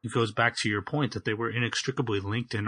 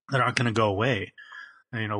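ordinary talk, nothing marked

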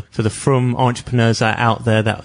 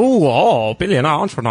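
imagined impressed listeners; 3 semitones higher than usual. Pretend. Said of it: "They're probably like".

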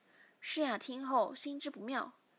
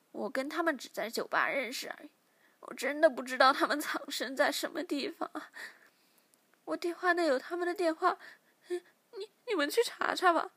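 frightened pleading; 5 semitones higher, 6 dB louder than usual. Acted. Captive begging interrogator.

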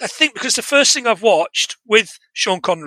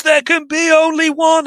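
high-pitched, half-restrained mock scream; 9 semitones higher than usual. Retelling the hero's tagline, mock screamed. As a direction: imitate a triumphant movie scream, pitch way up. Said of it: "And he screams".

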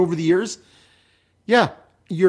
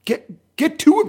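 exasperated urging; 9 semitones higher than usual. Pretend. Urging the absent writer.

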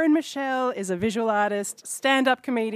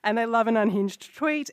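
usual speaking voice

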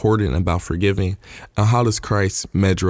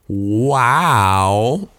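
long, drawn-out wow with swooping pitch; amazed wow. Real, not acted. Preacher reacting to the parable just read, amazed. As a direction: stretch it out in real amazement.

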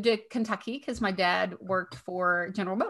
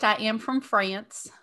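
normal talking voice

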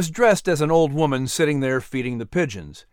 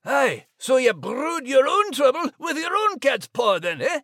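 much higher Scots jab; teasing Scottish Max; 10 semitones higher than usual. Acted. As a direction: needle her in a Scottish brogue. Max teases Liz.

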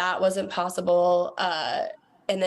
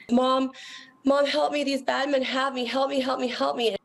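higher-pitched imitation of a sobbing daughter; retold sobbing plea; 6 semitones higher than usual. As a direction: plead for help, re-enacting a child's cries. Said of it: "crying and sobbing, saying".

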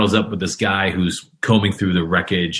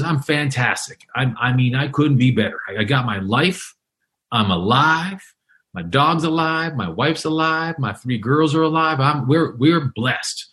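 high, beaming, overjoyed retelling; lit up; 4 semitones higher than usual. Retold or imitated. Storm survivor telling the reporter his family lived, excited and higher. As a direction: gush that everyone's alive. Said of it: "And this guy was lit up".

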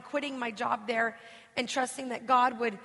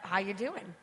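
low, soft cautious husband; tentative husband; 5 semitones lower, 6 dB quieter than usual. Retold or imitated. Husband unsure what's about to happen, tentative. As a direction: feel her out carefully, voice dropped. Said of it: "you try that for".

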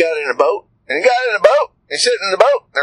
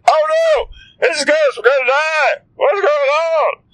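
mock terror; 11 semitones higher than usual. Disciples crying out at a ghost, hammed-up shrieking fright. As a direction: play the frightened disciples to the hilt. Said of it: "so they said".

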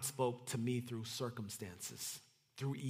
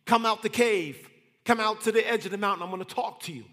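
loud, high call to a prophet; God calling out; 6 semitones higher, 10 dB louder than usual. Acted. God calling Ezekiel out of the cave, loud calling. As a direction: summon him out loud from afar. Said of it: "And then, and God said".

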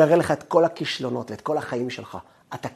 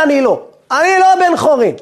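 high, loud self-denial; raised, emphatic acted protest; insistent protest; 8 semitones higher, 7 dB louder than usual. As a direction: blurt it out over your own doubts. Acting out the self still doubting.

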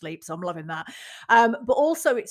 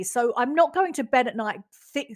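ordinary delivery

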